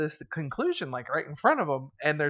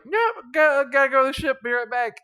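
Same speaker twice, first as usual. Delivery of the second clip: flustered exit; 9 semitones higher, 7 dB louder than usual. Retold or imitated. Priest fleeing, brushing them off.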